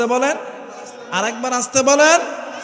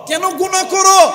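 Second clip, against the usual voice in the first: loud rebuke; 10 semitones higher, 7 dB louder than usual. Acted. Sermon, repeated rebuke.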